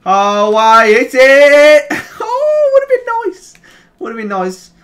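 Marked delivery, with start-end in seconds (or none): 0.0-1.9 long, loud rising shout; drawn-out cry, climbing louder; excited yell. Real, not acted. Near miss from long shot. 2.3-3.5 very high, squealed regret; high, half-laughing disappointment; amused regret. Real, not acted. Laughing after missed shot.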